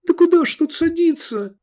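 high, loud horrified cry. A narrator's reading. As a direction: gasp in horror at the slope. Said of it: "ужаснулся Сергей".

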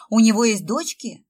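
loud eager burst; excited. Acted. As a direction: blurt it out, thrilled. Sveta jumps up at news.

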